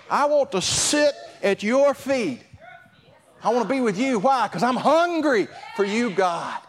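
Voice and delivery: impassioned, high-pitched appeal to God; fervent prayer. Acted. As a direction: cry out to God with urgent longing. Cries out in prayer while preaching.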